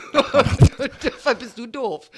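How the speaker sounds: slow, laughing jab; laughing insult. Acted. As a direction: drag it out through laughter.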